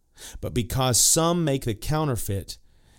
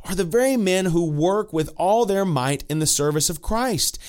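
normal talking voice

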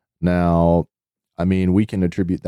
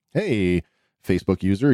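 normal talking voice

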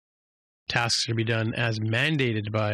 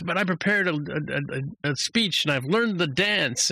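whiny captain; 7 semitones higher than usual. Retold or imitated. Captain whining about lost summit.

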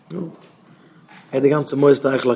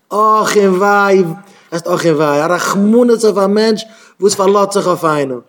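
woeful warning; 5 semitones higher, 6 dB louder than usual. Real, not acted. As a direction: cry woe, stretching the words. Lamenting whoever relies on people. (הויכע, געצויגענע קלאג)

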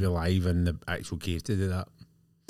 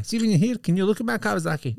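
much higher and quicker, cheeky request; high mock favour-asker; 12 semitones higher, faster than usual. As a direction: squeak it up fast and cheeky. Voicing a visitor asking favour.

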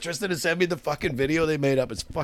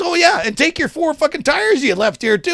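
high, loud mock order; heated, pitched-up afterthought; retold angry jab; 13 semitones higher, 5 dB louder than usual. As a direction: imitate a fed-up guy piling on. Retold shouting match.

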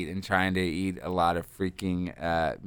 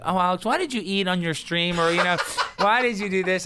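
higher, mock-nagging mom voice, laughing at the end; mom complaining; 9 semitones higher than usual. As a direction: imitate a fond mother scolding her son. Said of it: "she would complain".

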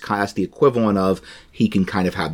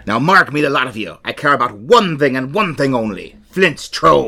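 emphatic, higher pirate declaration; 4 semitones higher than usual. Acted. Pirate declaring his one obsession to crew, pirate voice. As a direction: address the crew like a pirate captain. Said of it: "I'm gonna do a pirate voice".

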